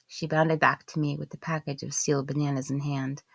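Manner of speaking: usual speaking voice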